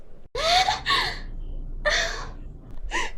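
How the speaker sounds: high, soft wordless squeal, too shy to answer; bashful high-pitched little noises. Shown as a demonstration. Girl hearing a boy's confession, high shy noise. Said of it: "she's too shy to answer".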